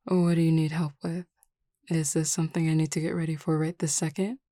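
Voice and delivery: usual speaking voice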